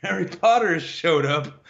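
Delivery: laughing imitation of a drunk; drunk and loud. Drunk dude greeting someone in a pub, imitated laughingly. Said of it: "he drunk and loud and having fun".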